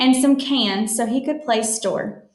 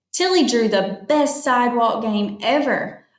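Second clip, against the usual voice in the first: usual speaking voice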